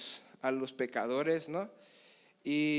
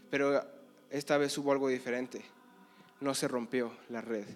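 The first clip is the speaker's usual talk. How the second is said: ordinary talk, nothing marked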